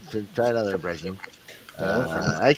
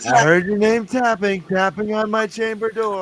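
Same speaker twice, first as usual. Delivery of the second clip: higher, louder parody of gothic verse; mock dramatic recitation; 8 semitones higher, 3 dB louder than usual. Host recalling a cartoon poem, high mock-dramatic recitation. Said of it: "have you seen Simpsons, um, The Raven".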